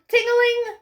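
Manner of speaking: high sing-song ting-a-ling; bright high bell chime; bell ring. A narrator's reading. Bell sound again.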